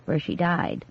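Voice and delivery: soft, low grim answer; low quiet murmur; hushed revelation. A narrator's reading. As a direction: let the dark truth drop quietly.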